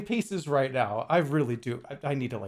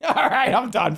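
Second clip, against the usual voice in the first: loud, high, through a laugh; laughing mock surrender; 8 semitones higher, 6 dB louder than usual. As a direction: give up with a big laugh. Jokingly gives up, laughing.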